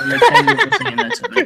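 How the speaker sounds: high-pitched talking through laughter; laughing. Real, not acted. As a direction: laugh as you thank them. Laughing at a mustache compliment.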